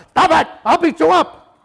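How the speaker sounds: loud, high threatening shout; aggressive yelling. Shown as a demonstration. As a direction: bark it like a bully. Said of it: "That's aggressive".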